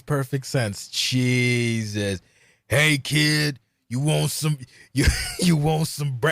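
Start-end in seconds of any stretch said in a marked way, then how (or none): 2.7-6.3 put-on gruff old-school wrestling promoter; old-timey wrestler voice. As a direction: ham up a classic wrestler. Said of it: "old-timey wrestling voice".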